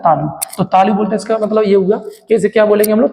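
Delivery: ordinary talk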